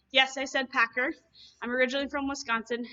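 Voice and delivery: ordinary talk, nothing marked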